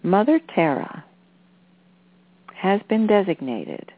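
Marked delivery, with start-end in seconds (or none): none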